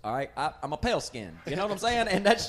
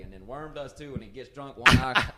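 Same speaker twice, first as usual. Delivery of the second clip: ordinary talk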